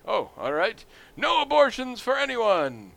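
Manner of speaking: high, loud backtracking proclamation; Bob Dole alien candidate. Retold or imitated. Candidate reversing for a booing crowd, hammed-up high proclamation. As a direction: switch sides and declaim it grandly. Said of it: "And he's like".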